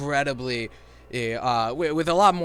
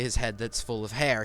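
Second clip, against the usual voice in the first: normal talking voice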